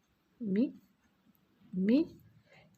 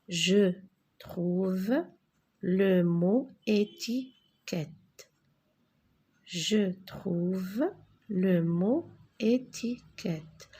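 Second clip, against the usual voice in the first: slow instruction; much slower than usual. Shown as a demonstration. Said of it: "teacher repeats slowly".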